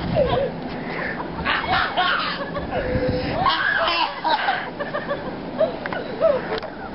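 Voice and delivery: high laughing shriek; scream-laugh from the cold. Real, not acted.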